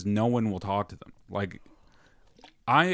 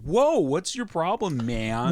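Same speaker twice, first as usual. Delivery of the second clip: slow, higher, indignant mock challenge; Denver homeless guy; 6 semitones higher, much slower than usual. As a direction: play a street guy offended at being told off. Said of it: "they'd be like".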